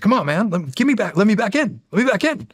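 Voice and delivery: high, insistent demon impression; Danny DeVito. Demon pestering me as I go to work, Danny DeVito voice. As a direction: nag and plead in a high put-on demon voice. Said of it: "he's got Danny DeVito's voice".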